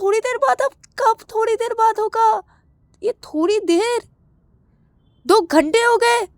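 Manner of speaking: high-pitched, ranting outrage; worked-up, high yelling complaint; agitated yelling. Acted. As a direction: rant with the voice pushed way up. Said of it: "तुम चिल्ला क्यों रहे हो".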